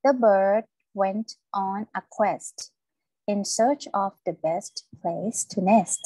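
slow reading, crisp final "st" on quest, best and nest; careful S endings. Shown as a demonstration. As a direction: read slowly, sound out every S in "quest", "best", "nest". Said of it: "ออกเสียง S นะคะ".

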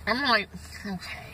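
words slurred by chewing; talking while chewing. Real, not acted. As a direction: mouth partly full of food. Seen 2: bit pizza at -2/-1; jaw and lip chewing motions during words.

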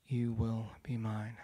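low, slow, intense whisper-like vow; claiming her from the photo, slow and low; solemn vow. Retold or imitated. Speaker vows to the girl in the picture, low and slow. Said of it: "and I say this".

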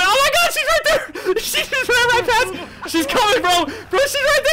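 very high-pitched, frantic cries; panicked shrieking. Real, not acted. Player spots her right there, panicked shrieking. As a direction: shriek it high, half laughing in terror. Monster appears right beside him.

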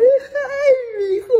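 put-on high wailing sob-voice of a distraught older woman; mock crying lady. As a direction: whimper it out like a weepy señora. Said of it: "llore y llore".

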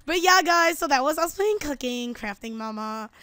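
usual speaking voice

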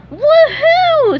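very high, loud cartoon whoop; squealing, over-the-top cartoon cheer; excited exclamation. A narrator's reading. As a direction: explode with joy. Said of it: "SpongeBob exclaimed".

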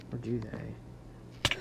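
slow, hushed and spooky; ominous quiet. Real, not acted. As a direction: breathe it slowly like a suspense line. Ominous aside while sneaking.

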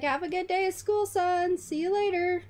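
high, quick parent's goodbye; dad's send-off. Acted. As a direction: call a cheery farewell to your kid. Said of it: "He's like".

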